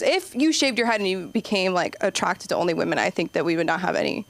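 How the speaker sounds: usual speaking voice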